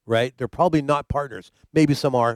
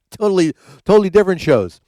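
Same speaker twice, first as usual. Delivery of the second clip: laughing; 5 semitones higher, 6 dB louder than usual. Real, not acted. Laughing at own comparison.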